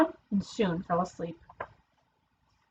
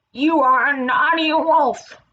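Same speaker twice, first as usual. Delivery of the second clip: slow, louder, higher scolding; scolding turtle; 5 semitones higher, 5 dB louder, slower than usual. Acted. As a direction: tell off a naughty child slowly.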